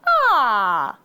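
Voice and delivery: long open ah vowel, loud; warm-up ah. Shown as a demonstration. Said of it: "like a vocal warm-up".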